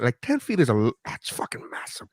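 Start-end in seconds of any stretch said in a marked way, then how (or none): 1.1-2.1 hushed awed whisper, almost no voice. Real, not acted. To the hosts, whispering about the huge wingspan. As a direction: breathe it out in disbelief.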